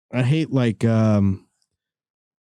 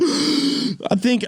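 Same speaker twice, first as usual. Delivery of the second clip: ordinary talk, nothing marked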